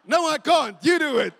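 high, shouted refusal; acted-out strained outburst; desperate protest. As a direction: yell back in a mock panic. Acted quarrel under the weight.

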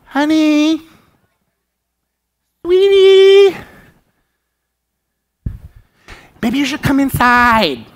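sweet, coaxing call with a high sweetie; mock gentle parent. Parent gently calling child from street.

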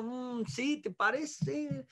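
mock half-hearted agreement; acted unhappy reluctance. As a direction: play a doubtful partner dragging out the words. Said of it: "no está muy contenta".

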